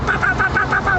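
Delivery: high "pá-pá" honking noises; rapid, high toots in a row; imitated car horns. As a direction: honk like a car horn with the voice. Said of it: "antigamente era buzinar".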